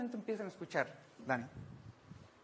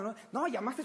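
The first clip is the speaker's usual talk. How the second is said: usual speaking voice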